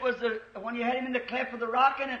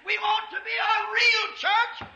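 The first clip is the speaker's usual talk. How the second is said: preaching shout; 8 semitones higher, slower than usual. Real, not acted. Preaching climax.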